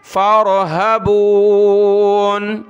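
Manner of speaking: फ़रहबून drawn out syllable by syllable; slow syllabled recitation. Shown as a demonstration. Teacher demonstrates the word slowly for students. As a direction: stretch फ़रहबून slowly, every syllable clear. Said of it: "फ़ा को रा के साथ मिलाया".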